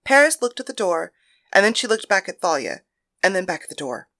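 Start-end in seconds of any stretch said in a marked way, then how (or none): none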